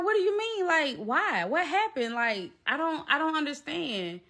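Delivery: high-pitched retold disbelief; bewildered. Retold bewildered reaction to breakup.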